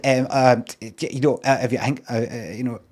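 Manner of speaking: halting, stammered filler words, acted out; ums and ahs. Shown as a demonstration. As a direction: stumble through it, imitating your own hesitant speech. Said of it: "saying it with me with ums and ahs".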